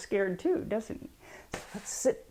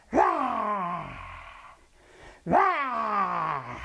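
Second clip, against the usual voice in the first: long demonstrated lion roar, falling away; open-mouthed "rah" roar. Shown as a demonstration. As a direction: roar like a mad lion for the kids. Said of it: "Good roaring".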